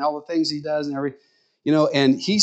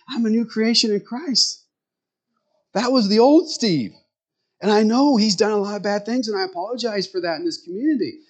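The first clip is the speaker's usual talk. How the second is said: higher, an earnest lightly voiced friend; retelling Steve's testimony in his raised, earnest voice; voicing Steve; 8 semitones higher than usual. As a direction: let Steve's new-creation conviction lift the pitch.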